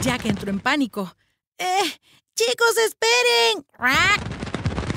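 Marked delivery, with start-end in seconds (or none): 1.6-3.6 higher, louder, desperate plea; anxious cry; panicked call. Acted. Panicked call after friends. 3.7-5.0 caw. Acted. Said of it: "graznó agitando las alas".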